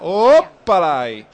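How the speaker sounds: drawn-out mock exclamation. Mocking a player's spin.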